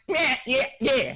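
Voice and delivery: high, mumbled mock agreement; tweaker impression. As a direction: yap it high like a garbling tweaker. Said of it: "That's what he sounds like".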